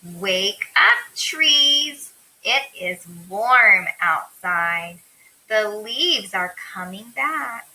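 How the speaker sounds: put-on slow, deliberate read-aloud for young kids; slow storybook voice; reading to children. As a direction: read it out slowly, word by word, lifting the opening call. Reads picture book to children.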